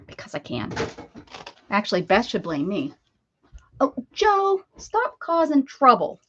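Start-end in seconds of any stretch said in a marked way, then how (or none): 3.8-6.2 higher, drawn-out mock telling-off; teasing reprimand, pitched up; mock scolding. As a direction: playfully scold a troublemaker, slowly. Teasing scolding of Joe in chat.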